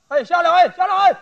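loud, high hollering to get down; shouting. Acted. Pedicab driver hollers at the cyclist to dismount, shouting. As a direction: yell urgent warnings across the street. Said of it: "有蹬三轮的冲我嚷".

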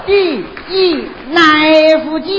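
put-on slow, heavy Dongbei-accented alphabet; 奶夫鸡 for F and G, drawn out; Northeast-accented English. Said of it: "请同学们注意我这个发音".

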